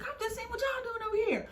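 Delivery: high, quick mock reply; hammed-up busybody voice; nosy driver. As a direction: pipe it up fast and high. Voicing nosy van driver.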